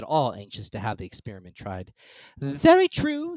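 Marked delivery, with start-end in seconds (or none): 2.4-3.4 put-on high, loud fussy old duchess. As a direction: prattle like a silly duchess. Duchess character voice.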